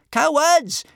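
high, loud jeering yell. A narrator's reading. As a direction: yell the insult after them. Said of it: "yelled Kamikaze over her shoulder".

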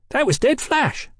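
put-on high-pitched excited street-boy call; boy's shout. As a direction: call out like a rowdy lad. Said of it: "one of them shouted".

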